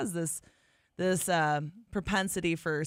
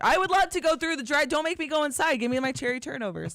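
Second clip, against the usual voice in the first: high, playful demand for pastries; mock demanding; 7 semitones higher, faster than usual. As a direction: plead in mock urgency, pitched up high. Mock plea for drive-thru pastries.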